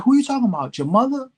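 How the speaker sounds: high, pressing mock confrontation; the mentor pushing the question back at him; pointed challenge. Mentor confronts him about lyric.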